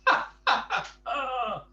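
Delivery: loud, high laugh out loud; Mister Tall finally laughing at his own legs; hearty laugh. Acted. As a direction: let the laugh burst out. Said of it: "he laughed out loud".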